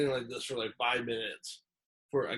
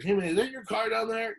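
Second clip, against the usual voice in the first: imitated calling out; 11 semitones higher, 4 dB louder than usual. Stranger calls from his car.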